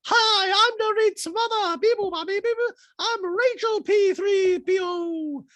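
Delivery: very high, slow squeaky robot voice; high mock robot mom; robot mother. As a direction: play the mother as a beeping droid. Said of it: "what if the mother was like".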